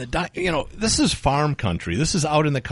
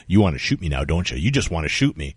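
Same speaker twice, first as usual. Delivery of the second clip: mock low husband; 8 semitones lower than usual. Husband accusing narrator.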